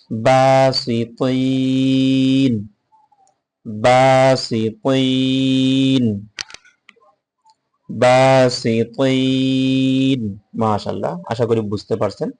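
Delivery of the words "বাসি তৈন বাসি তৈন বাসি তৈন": বাসি তৈন chanted very slowly, final ন held still; long melodic tajweed recital; slow Quran recitation. Shown as a demonstration. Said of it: "উচ্চারণটা পড়ব".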